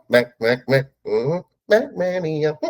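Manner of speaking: comic chant, building up in pitch; imitated movie bit. Guest imitating a movie character's short segment, playful impression. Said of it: "his little short segment he had".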